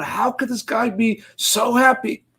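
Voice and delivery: put-on a boy asking in awe; amazed child. Child says it aloud.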